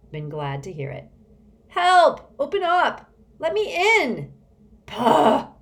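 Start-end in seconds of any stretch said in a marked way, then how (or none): 1.7-4.3 high, piercing shout; shrill cry for help. A narrator's reading. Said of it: "Constance's shrill voice". 4.9-5.5 loud, explosive scoff; sputtering. A narrator's reading. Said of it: "sputtered Mister Curtin".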